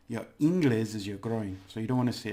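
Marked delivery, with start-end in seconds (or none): 0.4-0.9 "inglés" stressed on "in"; wrong stress, first syllable. Shown as a demonstration. Teacher warning it is your groin, stress on first syllable. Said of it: "if you put the stress here".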